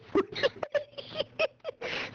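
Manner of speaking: squealing high laugh. Real, not acted. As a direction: break into shrill giggle. Laughing after teasing calls.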